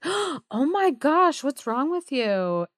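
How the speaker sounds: drawn-out mock horror at a rude friend; shocked. Fairies scold the third fairy, shocked and scandalised. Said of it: "the other two fairies are like".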